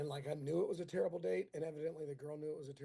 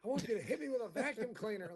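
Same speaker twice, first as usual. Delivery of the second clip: high, loud riff climbing into alarm; mock escalating request; 6 semitones higher, 5 dB louder than usual. Comic riffs on friend's girlfriend's fantasy, high mock alarm. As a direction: pile on the absurd demand, then balk in mock panic.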